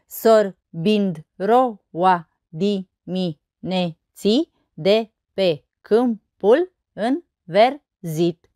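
very slow, each syllable separated. Shown as a demonstration. Teacher counting verse syllables on fingers, syllable by syllable. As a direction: count out the syllables one at a time. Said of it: "Și să nu uităm de degete".

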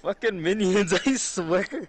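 laughing. Real, not acted. Laughing curse at game enemies.

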